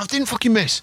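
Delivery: put-on high indignant kid yelling back; hammed-up high childish protest. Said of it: "I was like".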